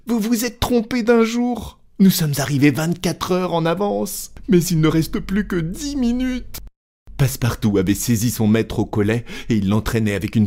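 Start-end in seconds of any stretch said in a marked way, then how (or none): 0.1-6.6 high-pitched, urgent outcry; agitated valet voice, pitched far up; frantic servant. Acted. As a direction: cry out the news in a high panic. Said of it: "s'écria Passepartout".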